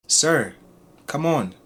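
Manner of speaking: ordinary talk, nothing marked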